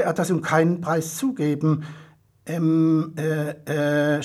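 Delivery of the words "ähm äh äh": hesitant hems, caught out; lightly voiced, understated stammer; unsure stammer. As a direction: stall for words nervously. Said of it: "stammelte er mit unsicherer Stimme".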